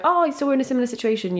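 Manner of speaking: high, quick quoted greeting; chatty opener. Retold or imitated. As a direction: imitate an eager new acquaintance, high and quick. Acting out new girlfriend's opener.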